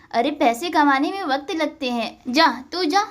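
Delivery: usual speaking voice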